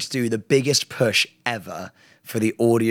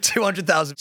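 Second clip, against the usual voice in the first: normal talking voice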